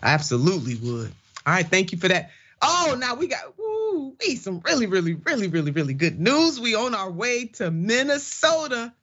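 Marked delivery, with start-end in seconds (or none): 2.6-8.9 high squealing joy; bubbling over with good news; giddy excitement. Real, not acted. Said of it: "I'm just so giddy about this story".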